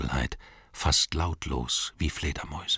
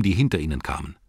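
ordinary delivery, nothing marked

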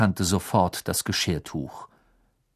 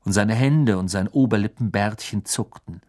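plain reading voice

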